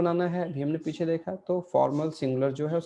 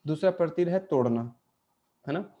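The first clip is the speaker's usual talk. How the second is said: ordinary talk, nothing marked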